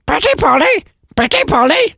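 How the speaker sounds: very high parrot squawk. Acted. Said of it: "So a law-breaking parrot there".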